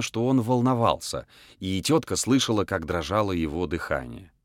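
plain reading voice

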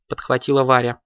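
plain reading voice

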